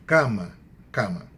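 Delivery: cama with a firm ca and light ma; stress on penultimate syllable. Shown as a demonstration. Teacher correcting the example, "cama" stressed on ca. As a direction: hit the first syllable of each "cama". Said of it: "cai na penúltima sílaba".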